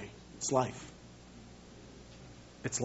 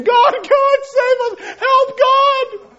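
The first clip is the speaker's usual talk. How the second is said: high, loud, hammed-up shout for help; mock panicked cry; 11 dB louder, much slower than usual. Preacher mimics his friend's panic in a dungeon, mock screaming. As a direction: scream for rescue, laughing at it. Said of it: "Mark Spence in the inner dungeon".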